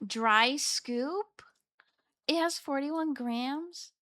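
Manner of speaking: normal talking voice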